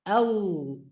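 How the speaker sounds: Al with the L held long; emphasized L. Shown as a demonstration. Teacher showing the emphasis in Allah, held L. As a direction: linger on the L of Al. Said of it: "the emphasis there".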